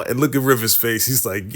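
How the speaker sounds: ordinary talk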